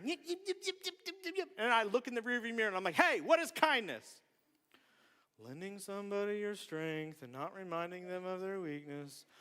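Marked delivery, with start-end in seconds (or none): 0.0-1.5 high-pitched rapid yipping; little yapping noises for bickering children; imitated squabbling kids. Preacher imitating kids fighting in the back seat, high yapping. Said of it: "I hear 'em". 3.0-4.2 raised, higher parental challenge; stern parent. Retold or imitated. Dad to kids fighting in the back seat, raised and stern. 5.4-9.3 droning, softer rote recital; kids reciting. Retold or imitated. Kids answering dad in the car, droning by rote.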